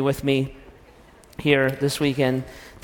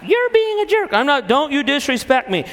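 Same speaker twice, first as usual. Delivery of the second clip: much higher, offended mock wife; high indignant wife voice; wife's voice; 10 semitones higher than usual. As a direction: snap back as the wife in falsetto. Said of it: "And so she".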